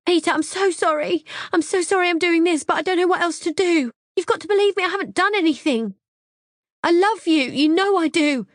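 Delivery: high, loud desperate begging; high tearful imploring voice. Acted. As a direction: plead with him for your life. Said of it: "imploring him".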